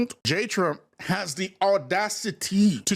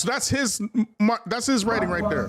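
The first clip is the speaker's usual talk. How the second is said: normal talking voice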